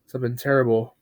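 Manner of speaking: ordinary delivery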